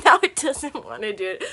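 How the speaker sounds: loud giggly complaint; amused, exasperated laughing. Real, not acted. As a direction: laugh at the broken lamp. Laughing at the lamp.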